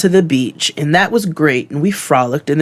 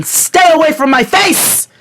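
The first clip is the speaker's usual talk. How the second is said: high exasperated exclamation; exasperated outburst; 12 semitones higher than usual. Real, not acted. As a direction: throw it up high at the bee. Ranting at the bees.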